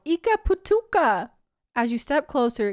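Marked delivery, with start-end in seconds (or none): 0.1-1.3 high, loud alien creature voice; Stitch cry. Acted. Stitch crying out from inside the chest. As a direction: yelp it like a little alien. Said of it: "Another unmistakable voice cries out".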